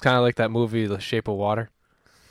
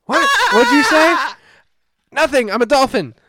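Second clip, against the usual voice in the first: very high, loud squeaky voice; 10 dB louder than usual. Retold or imitated. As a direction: squeal it like a dolphin. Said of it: "And he's like".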